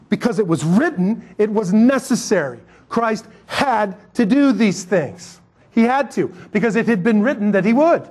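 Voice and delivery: preaching at full force. Acted. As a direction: build the point like a revival preacher. Preaching climax.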